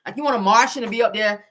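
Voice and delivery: ordinary talk, nothing marked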